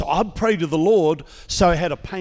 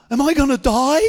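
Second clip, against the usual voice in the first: higher, louder mock-frightened cry; mock panic; 10 semitones higher, 8 dB louder than usual. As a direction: ham up his own panic at the doctor. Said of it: "I said".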